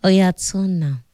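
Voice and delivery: ordinary talk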